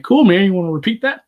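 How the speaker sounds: mock-agreeable confusion; baffled reply. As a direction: play puzzled but polite, asking for a repeat. Acting baffled reply to mumbler.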